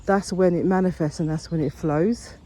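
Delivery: ordinary talk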